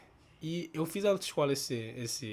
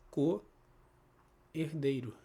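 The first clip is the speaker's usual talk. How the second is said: slow syllables; much slower than usual. Shown as a demonstration. Teacher spells out compound slowly.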